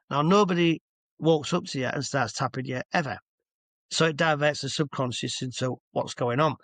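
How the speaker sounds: ordinary talk, nothing marked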